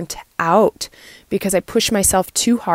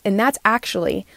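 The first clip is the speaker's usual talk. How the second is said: ordinary delivery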